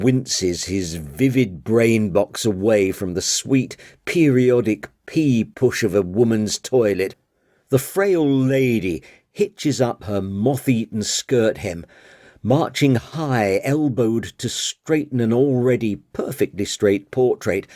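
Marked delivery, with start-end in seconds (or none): none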